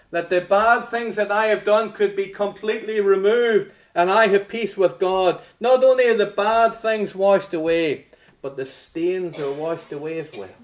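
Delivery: loud, high fervent preaching; preaching at full force. Acted. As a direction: preach it out with fire.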